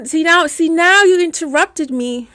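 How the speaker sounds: ordinary talk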